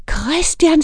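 put-on a boy startled by what he saw; boy's voice. A narrator's reading. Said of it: "sagde han".

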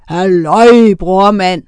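loud, drawn-out monster roar; booming, roaring hail; roared greeting. A narrator's reading. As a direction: bellow it like a big sea serpent. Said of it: "brølede den".

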